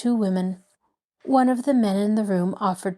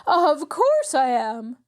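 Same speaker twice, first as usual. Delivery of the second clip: higher, slow, offended outburst; indignant scream; 6 semitones higher, slower than usual. A narrator's reading. Mrs Willoughby to the doubting guests, indignant cry. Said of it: "Missus Willoughby screamed".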